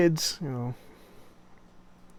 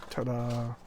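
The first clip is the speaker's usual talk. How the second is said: ordinary delivery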